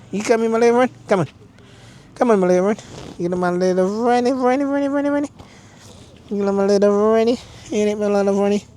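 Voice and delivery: high, repetitive cooing; coaxing pet talk. Real, not acted. Coaxing a little animal friend.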